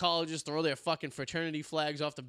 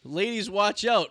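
louder, slow and swaggering; cocky announcement; 6 semitones higher, 9 dB louder, slower than usual. Pretend. Man on spring break warning ladies, swaggering. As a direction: strut it to the ladies. Announcing himself to college girls.

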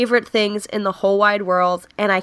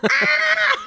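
sudden high yelp of surprise; shrieking gasp of delight; startled yelp. Retold or imitated. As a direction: yelp like you just got the joke. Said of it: "And I was like".

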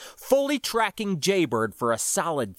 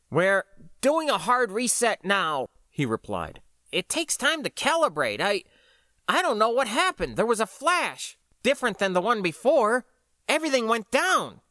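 higher, rattled voice; shocked, overwhelmed; 7 semitones higher than usual. A narrator's reading. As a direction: speak like he can't take it in. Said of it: "John still sounded shocked, overwhelmed".

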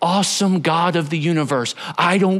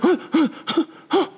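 short high gasps, one after another; acted breathless gasping; shocked panting; 12 semitones higher, faster than usual. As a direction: gasp in little bursts after a big scare. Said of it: "And you're like".

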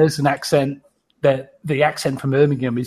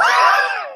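squealing burst of laughter, pitched way up; high shrieking laugh. Real, not acted. After the accent demo.

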